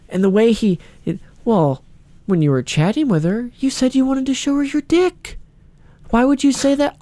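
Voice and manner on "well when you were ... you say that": put-on calm interrogator, pitched high; Chris Hansen. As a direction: confront the suspect in a high, measured voice. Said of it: "he talks all calm".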